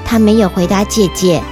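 ordinary delivery

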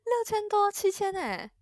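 very high, loud cry of disbelief; high-pitched, loud astonishment; shocked. Real, not acted. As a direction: blurt the price out in shock. Listener shocked at the bill.

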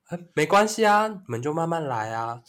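retelling the old professor's chilly tone, lightly voiced; cold professor. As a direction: imitate a cool, sarcastic professor, lightly. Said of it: "用这种语气".

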